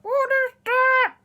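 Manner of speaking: high, slow mangled words from a frozen mouth; unable to articulate. Acted. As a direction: say it loudly without moving your mouth. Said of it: "But they couldn't say it".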